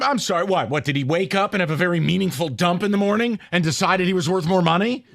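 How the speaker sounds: normal talking voice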